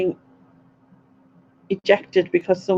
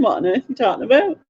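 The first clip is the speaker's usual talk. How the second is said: incredulous thought; 4 semitones higher, much faster than usual. Retold or imitated.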